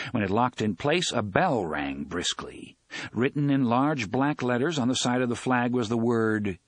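plain reading voice

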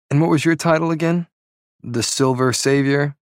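high, rising mock question; teasing taunt. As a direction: pretend to forget, pitch lifted to needle her. Prince taunts her about title.

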